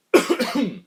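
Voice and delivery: hard, harsh smoker's cough; coughing fit. Real, not acted. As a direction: cough like you're choking. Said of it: "I'm choking on cigarettes".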